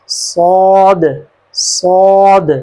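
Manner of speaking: صاد with a clear whistle and a heavy, thick emphatic s; heavy Saad demo. Shown as a demonstration. Teacher repeats صاد for students, heavy and clear. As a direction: say صاد twice, full and heavy. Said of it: "سیٹی کی آواز بھی آنی چاہیے clear اور موٹی آواز".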